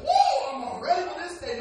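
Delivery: high, loud whoop, then brisk eagerness; springing out of bed. Acted. Preacher acting a young person waking up, exuberant whoop. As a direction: whoop like you just leapt out of bed. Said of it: "jump out of bed and say".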